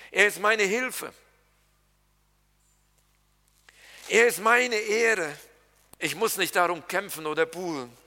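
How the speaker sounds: building preacher's litany; preaching refrain. Acted. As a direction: lean on each er ist. Preaching refrain er ist mein.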